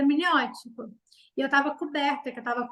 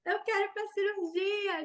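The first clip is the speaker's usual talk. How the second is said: desperate no; 8 semitones higher, slower than usual. Retold or imitated. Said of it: "Eu falei".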